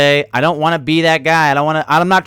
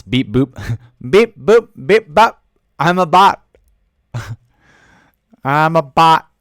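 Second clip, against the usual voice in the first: robot voice; 3 semitones higher, 4 dB louder, slower than usual. Pretend. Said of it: "we're all bots".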